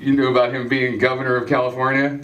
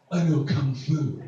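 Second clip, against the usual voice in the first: Keanu line; 3 semitones lower, 7 dB quieter, slower than usual. Acted. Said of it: "be like".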